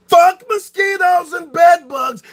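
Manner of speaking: slow, high, loud curse; angry shout. Real, not acted. Cursing the mosquitoes and bed bugs he hates, furious shout.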